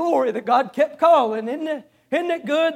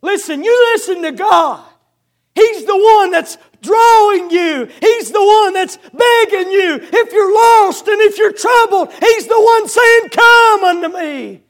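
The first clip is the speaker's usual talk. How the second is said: loud, ringing preacher's plea; preaching at full force; 6 semitones higher, 7 dB louder than usual. Acted. Preacher to lost sinners, loud pleading call.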